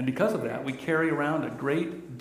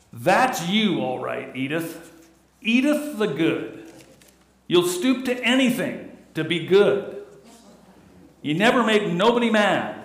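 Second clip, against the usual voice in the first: loud, higher blustering Archie rant; Archie Bunker voice; 4 semitones higher, 5 dB louder than usual. Retold or imitated. Husband scolding his wife in a loud higher Archie voice. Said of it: "saying to his wife".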